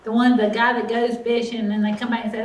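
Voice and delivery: normal talking voice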